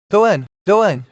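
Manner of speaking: loud, high angry shouting; angry voices yell at him to go in. A narrator's reading. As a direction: voices behind shout angrily at the brother to go in. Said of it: "shouted angry voices behind".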